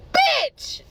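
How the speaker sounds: high, loud, punched cry; indignant outburst. Retold or imitated. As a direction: burst out with outraged emphasis. Furious reveal of another woman.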